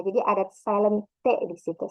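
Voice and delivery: normal talking voice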